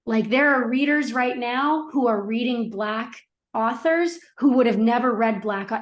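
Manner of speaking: ordinary talk, nothing marked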